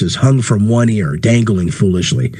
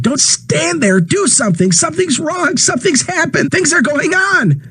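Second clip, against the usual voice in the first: frantic pleading. Acted. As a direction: plead desperately at the crowd. Ed screaming at bystanders.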